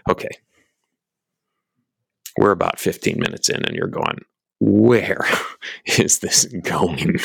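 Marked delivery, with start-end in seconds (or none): 4.6-7.2 laughing as you ask; mock bewilderment. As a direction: playfully voice a lost listener, breaking into laughs. Voicing a lost listener, laughing.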